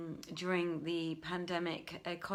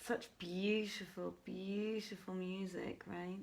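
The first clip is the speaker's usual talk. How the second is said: softer and slow, savouring each word; soft and admiring; 5 dB quieter, slower than usual. Real, not acted. Host admiring the music to her listeners, soft and slow. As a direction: murmur it as if lost in the music.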